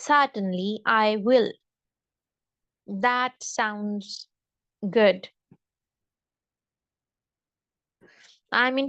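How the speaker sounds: very slow, each phrase read out for the class. Shown as a demonstration. Teacher reading vocabulary phrases to students, very slow. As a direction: spell each phrase out slowly for pupils.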